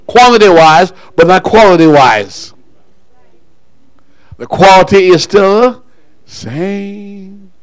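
high, loud, driving preacher's delivery; rally the church into praise at full voice; full-force preaching. Real, not acted.

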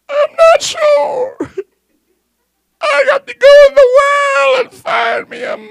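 high, loud, crying shout; hammed-up crying girl refusing to wait; wailing girl. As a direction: sob and shout it out. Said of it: "girl crying to pastor".

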